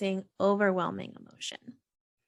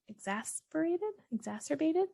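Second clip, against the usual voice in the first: higher, softer, questioning rise at each try; unsure attempt; 7 semitones higher, 6 dB quieter than usual. Real, not acted. Podcast host stumbling over a hard word, tentative and questioning.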